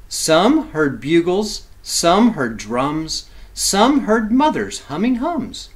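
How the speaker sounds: slow, rhythmic chant of each line; rhythmic rhyme reading. A narrator's reading. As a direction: savor every rhyme for the kids. Rhythmic rhyming list.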